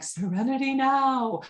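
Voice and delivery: lightly voiced Kramer yelling serenity now; a hint of Kramer's frantic cry from Seinfeld; Kramer impression. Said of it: "Kramer's like".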